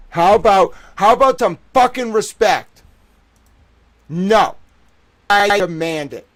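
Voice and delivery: high, loud demand for respect; furious shout. Real, not acted. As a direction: bellow it, fed up.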